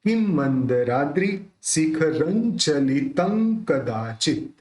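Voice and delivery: slow, careful recitation of the line; slow demo. Shown as a demonstration. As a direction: spell the line out slowly for students. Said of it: "कैसे निकलेगा?".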